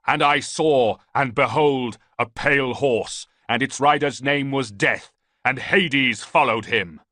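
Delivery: loud, high chant, slowing on the last lines; prophetic shouting. A narrator's reading. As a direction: proclaim the vision over the fighting. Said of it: "He was shouting".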